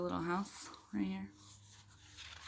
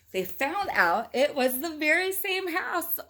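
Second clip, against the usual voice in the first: excited reveal; 9 semitones higher, 8 dB louder, slower than usual. A narrator's reading. Big reveal read to child.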